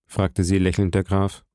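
plain reading voice

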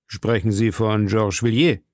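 plain reading voice

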